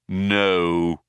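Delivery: drawn-out robotic monotone; thin, metallic robot tone. A narrator's reading. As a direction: answer like a sulky robot. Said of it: "came a thin metallic voice".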